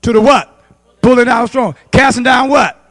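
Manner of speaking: loud, punched preacher prompts; rousing call-out. Real, not acted. Preacher quizzing congregation on the verse, rousing call-out.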